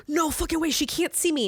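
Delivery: high, alarmed retelling; mock panic. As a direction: imitate someone frantic not to be seen. Retells friend ducking in panic.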